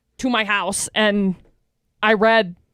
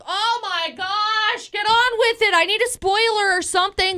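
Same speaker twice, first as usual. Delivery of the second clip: exasperated; 8 semitones higher than usual. Retold or imitated. Bored viewer venting retold.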